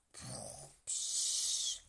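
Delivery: snore, then puff out air; sleepy snore and sigh; snoring. A narrator's reading. Animals snoring.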